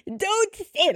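high-pitched crying plea, hammed up; dramatic, tearful. Daughter pleading with mom on coming home, dramatically tearful. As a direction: imitate yourself wailing at someone. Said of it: "I'm so dramatic. I'm, like, crying".

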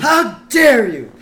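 high, loud, drawn-out indignation; outraged boss. Acted. Boss insulted from the stage, hammed-up outrage. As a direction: bellow it slowly as if mortally offended.

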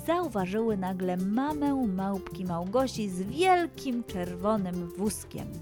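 usual speaking voice

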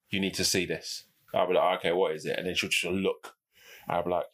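hushed retold call. Wife calls him, retold low.

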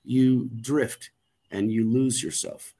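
ordinary delivery

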